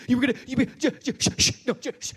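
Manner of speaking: high, loud stammering; flustered stammer. Retold or imitated. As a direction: trip over the first sounds, flustered. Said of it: "I'm trying to get one thing".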